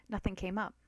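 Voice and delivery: plain reading voice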